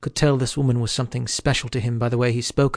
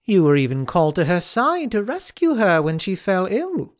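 higher sly knight voice, grinning; teasing Kay; 6 semitones higher than usual. Acted. Sir Kay ribbing Arthur about his lady, high and sly. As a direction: lean in with a knowing tease. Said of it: "Kay carried on".

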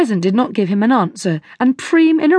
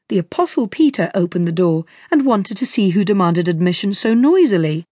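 ordinary delivery, nothing marked

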